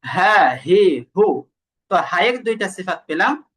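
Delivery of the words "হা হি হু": "হা, হি, হু" with open airflow on every h; airy h syllables for demonstration; breath flowing out. Shown as a demonstration. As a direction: push breath freely through "হা, হি, হু". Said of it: "দেখেন বাতাস বের হয় কিন্তু।".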